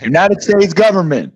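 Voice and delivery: loud, high government agent announcement; official bark. Acted. Fed pounding on the door, loud official announcement. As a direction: boom it through the door like a fed.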